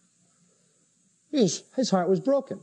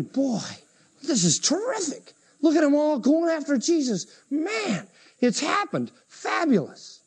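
high, gushing mock excitement; elated disciples; 8 semitones higher than usual. Disciples thrilled at everyone going after Jesus, gushing. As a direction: marvel aloud, pitched high. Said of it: "They were probably thinking".

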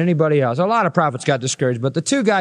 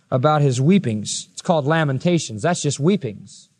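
ordinary talk

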